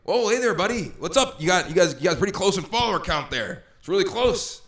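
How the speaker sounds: hammed-up friendly dad; jovial dad impression. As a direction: play a chummy dad bragging about follower counts. Imitating Jennings' dad.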